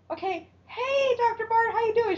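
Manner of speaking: high sing-out hello, imitating a cover-up; mock cheery greeting. [name] covering as Hope comes by, mock cheery.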